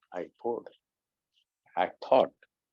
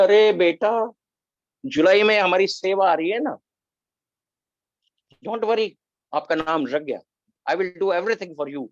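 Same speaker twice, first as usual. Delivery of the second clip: reassuring mentor; 7 semitones higher, 4 dB louder, much faster than usual. Retold or imitated. Mentor reassuring the speaker warmly.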